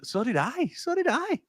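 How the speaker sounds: mocking the rival fan in a squeaky voice; mocking whine. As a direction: parody the rival fan's whine. Mocking the rival fan's claim.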